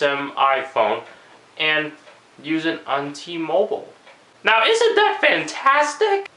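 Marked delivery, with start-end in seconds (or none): 4.4-6.3 high, cracking, slow exclamation. Real, not acted. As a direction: let the voice jump up high and crack. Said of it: "I'm sorry if my voice is cracking".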